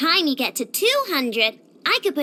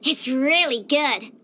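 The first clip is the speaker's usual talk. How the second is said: normal talking voice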